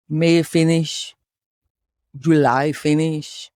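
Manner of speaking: put-on slow, drawn-out Russian accent. Host listing months of waiting, slow Russian accent. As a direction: count off the months slowly as a Russian. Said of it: "could you do a Russian accent".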